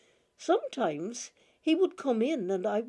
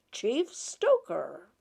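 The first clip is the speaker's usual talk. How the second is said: slow, lightly voiced master; fond nickname; 3 semitones higher, much slower than usual. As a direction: drawl the title with mock ceremony. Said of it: "he called me".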